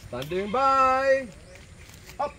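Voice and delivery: slow drawn-out high "Standing by"; range command. Real, not acted. Range officer before the buzzer, slow formal call.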